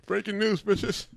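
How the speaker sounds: high, punchy mock anchor; news announcer voice. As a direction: announce it like a hammy newscaster.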